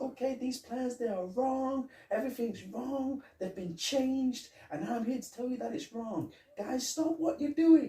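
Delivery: high, fast put-on official voice; mock inspector barking orders; bossy inspector. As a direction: order the workers around in a squeaky fake authority. Imitating Foley as inspector.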